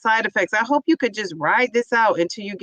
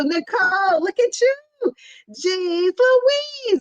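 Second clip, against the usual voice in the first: excited cheering; 7 semitones higher than usual. Real, not acted. Cheering viewer reaching goal.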